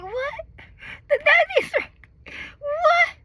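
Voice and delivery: high, loud, stunned cry; can't believe it's happening now; shocked disbelief. Retold or imitated. Mother told the baby is right there, shocked cry. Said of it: "I'm like".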